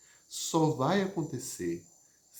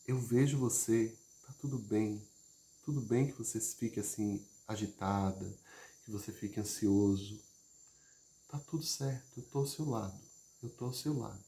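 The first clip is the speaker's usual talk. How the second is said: hushed tender comfort with long pauses; safe, reassuring demo; 2 semitones lower, 5 dB quieter than usual. Shown as a demonstration. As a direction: speak gently to calm someone anxious. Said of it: "a demonstração do que seria o oposto".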